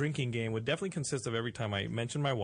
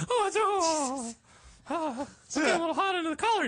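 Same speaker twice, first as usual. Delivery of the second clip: very high squeaky cartoon mouse; flustered Mickey squeak; 13 semitones higher, 3 dB louder than usual. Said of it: "That is an awesome Mickey Mouse".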